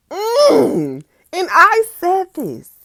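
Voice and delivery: high, loud excited outburst; delighted high squeal. Real, not acted. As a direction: burst out thrilled. Excited reaction to own journal.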